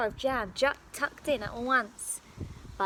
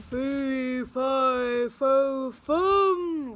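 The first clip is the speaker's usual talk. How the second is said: loud slow booming roar; roaring giant; 2 semitones higher, 7 dB louder, much slower than usual. Acted. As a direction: roar it out to scare. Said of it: "roared the giant".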